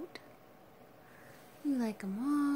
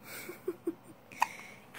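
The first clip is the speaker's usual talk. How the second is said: soft breathy laugh; 4 semitones higher, 5 dB quieter than usual. Real, not acted. Amused by baby.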